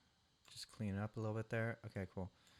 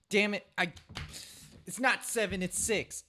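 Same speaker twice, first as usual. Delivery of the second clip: frustrated self-correction; 9 semitones higher, 6 dB louder than usual. Real, not acted. Catches his own mistake.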